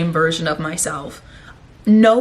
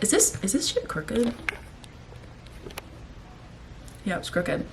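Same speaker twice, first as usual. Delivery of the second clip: much softer, muttered to yourself; quiet aside; 12 dB quieter than usual. Real, not acted. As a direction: drop the volume for a private check. Aside to self while checking something.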